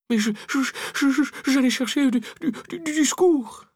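high, flustered stutter; stammering excuse. A narrator's reading.

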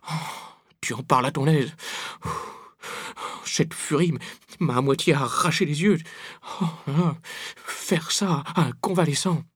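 high, panting, moaning complaint; whining groans. A narrator's reading. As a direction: moan between gasps, feeling sorry for yourself. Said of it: "Pépé geignait".

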